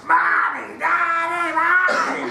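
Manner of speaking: high, loud imitated toddler wail; a kid on a plane screaming for his parents; child screaming. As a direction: shriek it like a kid in pain. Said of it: "and screaming and screaming".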